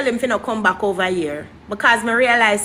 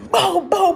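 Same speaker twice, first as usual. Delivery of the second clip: high-pitched mock gun noises; imitated gunshots; 8 semitones higher than usual. Woman retelling shooting after a fleeing man, imitated gunshots. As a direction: fire off high verbal gunshots.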